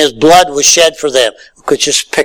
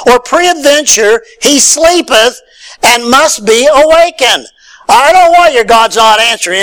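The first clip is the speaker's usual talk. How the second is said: a higher, scornful sneer about a sleeping god; mocking taunt; 6 semitones higher than usual. Elijah mocking the priests of Baal, higher taunting voice. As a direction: needle them with mock concern. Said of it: "Elijah kind of mocked them".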